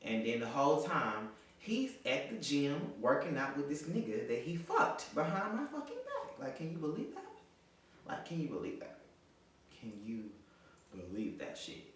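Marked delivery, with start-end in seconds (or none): 1.6-6.4 indignant outrage. Real, not acted. Woman telling viewers of his cheating, indignant outrage. 6.4-9.0 high-pitched disbelief; incredulous. Real, not acted. Woman to viewers about his betrayal, high incredulous question. 9.8-11.9 deliberate disbelief. Real, not acted. Repeats the question low, broken up.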